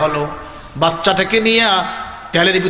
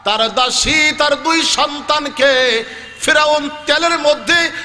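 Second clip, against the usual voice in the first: high, chanting sermon delivery; full-force preaching; 10 semitones higher than usual. Acted. Prophet asks Gabriel about paradise's fragrance, high impassioned preaching. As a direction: cry out the dialogue with long-held endings.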